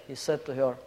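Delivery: normal talking voice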